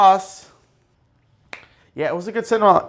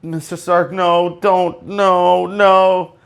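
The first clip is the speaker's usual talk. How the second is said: Spider-Man; 5 semitones higher, much slower than usual. Retold or imitated. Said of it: "And then Spider-Man's like".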